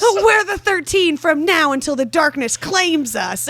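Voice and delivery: high, loud, hammed-up vow with laughter; played-up battle cry, pitched high; mock-heroic group vow. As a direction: recite the oath in exasperated, giggly mockery. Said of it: "And then they're all like".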